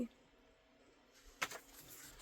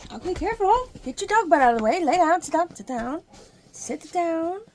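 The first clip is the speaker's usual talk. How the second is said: louder, higher commands; raised voice telling the dog to sit; 8 semitones higher, 8 dB louder than usual. Real, not acted. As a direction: boss the dog into sitting down. Ordering dog to lie down.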